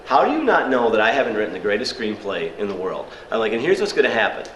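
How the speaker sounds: ordinary talk, nothing marked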